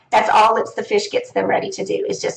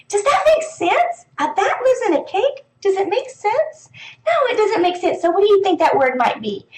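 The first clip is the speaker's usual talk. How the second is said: teacher questioning; 9 semitones higher than usual. Shown as a demonstration. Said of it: "which is".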